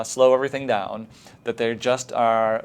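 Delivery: usual speaking voice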